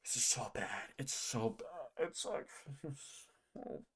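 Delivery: breathy near-whisper, slow; hushed dismay. Real, not acted. Groaning quietly at a weak card. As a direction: let it drop to a stunned hush.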